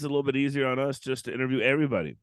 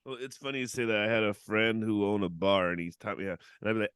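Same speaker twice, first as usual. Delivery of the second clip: usual speaking voice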